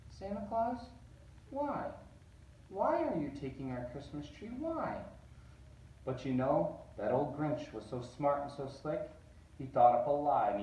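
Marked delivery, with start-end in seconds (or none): 0.1-5.0 put-on high small child's voice; little girl. Cindy Lou Who questioning the fake Santa, high child voice. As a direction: ask it like a puzzled toddler. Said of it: "She stared at the Grinch and said".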